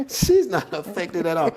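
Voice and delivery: high-pitched, laughing through the words; real amused exasperation; laughing disbelief. Real, not acted. Amused disbelief at wife's calm.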